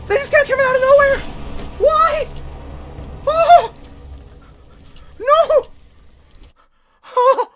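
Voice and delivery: very high, loud screams of terror; real shrieks while being overrun; shrieking panic. Real, not acted. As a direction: shriek as they keep coming. Ambushed by Deathclaws, screaming.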